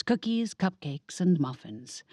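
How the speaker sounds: usual speaking voice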